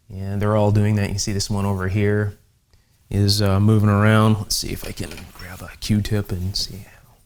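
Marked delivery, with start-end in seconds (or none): 4.4-7.1 whispered aside. Real, not acted. Muttering aside while grabbing Q-tip.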